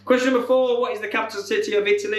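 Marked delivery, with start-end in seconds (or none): none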